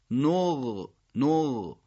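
نغ with a long, continuous gh; drawn-out ghayn. Shown as a demonstration. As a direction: let the end of نغ stretch on the ghayn. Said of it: "اون دوست داره کشیده تر باشه". (نغ با غینِ کشیده)

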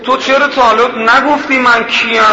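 high, loud imploring plea, slowing on ببخش منو; pleading wife. Retold or imitated. Wife clings to her husband and begs forgiveness, high and loud. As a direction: beg him to forgive, half in tears.

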